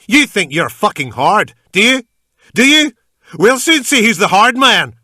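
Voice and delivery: high, loud mocking snarl; taunting yell. A narrator's reading. Gunman taunting the man with the pistol in his mouth, yelling. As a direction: jeer at him, rising to a scream.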